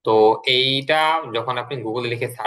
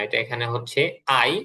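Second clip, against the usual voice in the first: ordinary delivery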